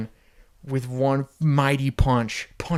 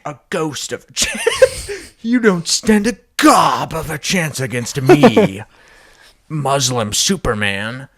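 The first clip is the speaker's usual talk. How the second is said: laughing villain taunt; 3 semitones higher, 7 dB louder, slower than usual. Acted. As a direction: gloat and laugh at him.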